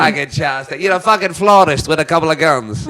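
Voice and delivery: a mild, hammed-up Boston accent. Shown as a demonstration. Guy tries Boston accent for friends, mild hammed-up Boston accent. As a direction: toss off a light Boston tough-guy line. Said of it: "Is that a good one or not?".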